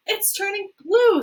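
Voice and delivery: high, loud panicked scream. Acted. A mother screaming at her daughter's blue nose, high and loud. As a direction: shriek it in horror. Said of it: "screamed Missus Beauregard".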